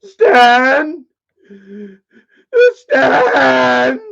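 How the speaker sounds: loud high yell of a name; calling out. Pretend. Calling his friend Stan with a loud yell. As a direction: holler across the house.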